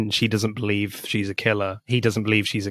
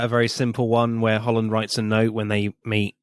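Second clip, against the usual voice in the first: ordinary delivery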